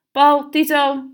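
high, loud call across the house. A narrator's reading. Mother calls her sons to announce a visitor, loud call. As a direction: call the boys from the door. Mother calling boys from door.

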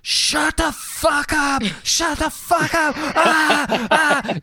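high, drawn-out mock scream; stretched-out shrieked outburst. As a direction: imitate losing it at a dog. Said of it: "screaming at his dog".

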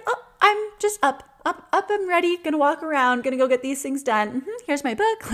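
imitating an eager little boy, pitched high; enthusiastic young son. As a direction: play a five-year-old popping straight out of bed. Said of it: "does it with enthusiasm".

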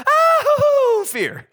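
squeaky high gasped "Oh, fear" with a laugh; mock terror. Preacher mocking a frightened Bible figure, squealing. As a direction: play the terrified witness for laughs. Angel appearance, universal response.

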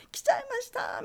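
high, playful announcement; excited. Retold or imitated. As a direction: sing it out bashfully proud. Announcing arrival to teacher.

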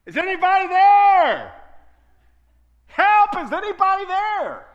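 high, loud cry for help into the dark; desperate calling out. Acted. Man hanging from a ledge shouting into the darkness, desperate. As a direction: yell down into the ravine, desperate. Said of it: "he calls out".

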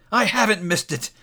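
high, excited rush; elated relief. A narrator's reading. Scrooge learning he hasn't missed Christmas, elated relief. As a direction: exclaim it in joyful amazement.

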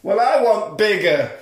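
high, loud sassy retort. Acted. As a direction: toss it back with attitude. Reenacting nurse's reply.

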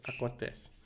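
whispered warning. Real, not acted. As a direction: breathe it as a secret. Quiet warning after skeptic.

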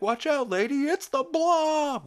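high, loud alarmed shout; panicked mock warning. Chris warning the lady on TV, panicked mock yell. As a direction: yell it high, stretching the last word.

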